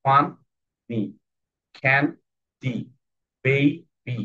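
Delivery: each syllable alone with a pause: ফান, নি, can, ডি. Shown as a demonstration. Teacher showing two syllables per word, ফান ... নি, can ... ডি, spoken apart. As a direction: break funny, candy and baby into separate beats. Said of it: "দুটো syllable এসেছে".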